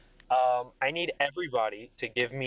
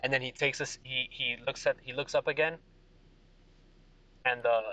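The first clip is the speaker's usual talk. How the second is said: normal talking voice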